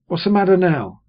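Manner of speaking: a sister cutting in at the table; sister, said smartly. A narrator's reading. Sister snapping at the table, sharp and loud. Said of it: "she said smartly".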